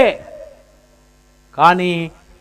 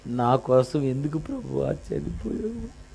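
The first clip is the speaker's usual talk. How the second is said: a hint of put-on weeping, much softer; hammed-up false sob to the Lord; softly faked tearful lament; mock fake crying; 7 semitones lower, 14 dB quieter than usual. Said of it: "ఒక దొంగ ఏడుపు ఒకటి ఏడ్చి".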